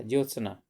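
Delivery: ज्योत्सना with half त and half स, ज्योत-सना; careful correct spelling. Shown as a demonstration. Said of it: "यानी ता भी आधा, सा भी आधा".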